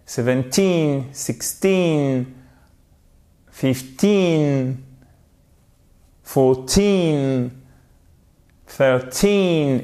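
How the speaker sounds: put-on each number with a long falling "teen"; stress on TEEN. Shown as a demonstration. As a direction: lean on the end from "Seventeen" down to "Thirteen". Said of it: "c'est la dernière syllabe, le teen, qui est accentué". (accent sur chaque « teen » long)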